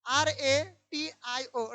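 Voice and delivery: each letter spaced out, slow and clear; spelling it out slowly. Shown as a demonstration. Teacher to class, spelling out ratio slowly letter by letter. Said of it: "रेटियो नहीं पढ़ना है, उसको रेशियो पढ़ना है".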